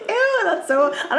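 high, fast giggly ew; grossed out at the armpit kink; laughing disgust. Real, not acted. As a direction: squeal it out half grossed out.

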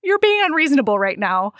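high, pleading protest; exasperated scolding. Retold or imitated. Reader chiding the book's heroine, exasperated. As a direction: call out the name high, then chide.

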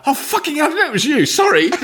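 very high, flustered cry; shocked blurt. Retold or imitated. Realising the baby's mother is Rosamund Pike, high shocked blurt. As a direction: blurt it in panicked embarrassment. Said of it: "I said something like".

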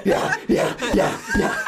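breathless laughing yeahs. Real, not acted. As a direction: laugh through the words. Laughing at raging barbarian.

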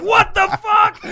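high, disbelieving cry through laughter; outraged disbelief, half laughing; retold shocked yell at a bad call; laughing outrage. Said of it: "I was like".

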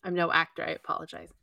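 ordinary talk

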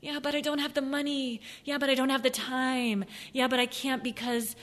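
high, whining "yeah, but" excuses; mock the guy who always has an excuse; whiny excuse-maker. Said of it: "the guy that's always".

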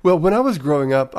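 ordinary talk

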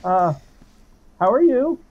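high-pitched, over-friendly small talk with hesitations; awkward friendly greeting. Acted. Exposed sneaker chatting up goblins about their roses, awkwardly friendly. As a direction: chirp pleasantries as if caught out. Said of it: "I'm just going to stand up and go".

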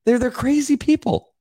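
high, emphatic outrage; heated rant. Real, not acted. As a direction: pitch it up in disbelief. Ranting about politicians.

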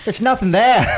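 loud, high mock Australian, laughing; boisterous put-on Australian voice; Australian accent. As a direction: shout it as a hammy Aussie.

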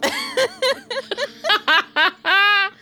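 long, high real laugh; helpless high cackle; burst of laughter. Real, not acted. Table laughs at Grana getting up.

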